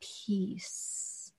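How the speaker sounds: "peace" breathed out almost silently; hushed peace. Shown as a demonstration. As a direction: let "peace" fade to a whisper. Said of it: "God will always bring".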